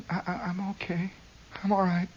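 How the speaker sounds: soft, high, stammered reply; quiet mumble. Retold or imitated. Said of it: "I says".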